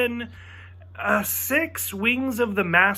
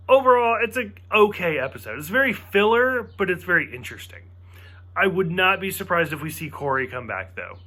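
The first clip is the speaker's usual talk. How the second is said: ordinary talk, nothing marked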